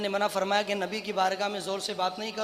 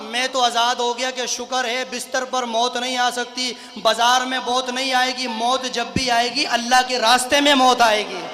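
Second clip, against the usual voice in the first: raised, emphatic pulpit delivery; impassioned preaching; 3 semitones higher, 7 dB louder than usual. Retold or imitated. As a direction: proclaim it with swelling conviction. Raised preaching of the companion's certainty.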